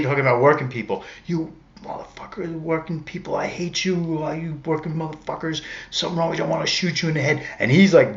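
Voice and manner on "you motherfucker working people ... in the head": mock raving rant, pitched higher; hammed-up subway ranter, higher voice; lightly voiced angry stranger's rant; imitating a raving stranger. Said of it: "And he's saying".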